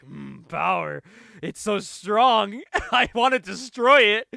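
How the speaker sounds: hammed-up craving for destruction; power-hungry outburst. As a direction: draw out "strong", then build into a laughing mock yell. Acting out the power-drunk Living Bomb.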